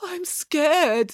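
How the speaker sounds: helpless. Acted. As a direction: fake being frightened. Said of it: "said Tom helplessly".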